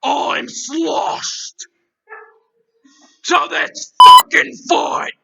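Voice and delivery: hammed-up sloshed threat, the last words slow and loud; mock drunk brawler. Host pretending to be sloshed and challenging the intruder loudly. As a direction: play a drunk itching for a fight.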